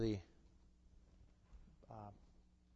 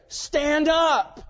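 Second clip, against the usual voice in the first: sharp, much louder and higher order; commanding shout; 13 semitones higher, 12 dB louder than usual. Shown as a demonstration. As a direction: bark it as a command that cannot be refused. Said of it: "It's an imperative".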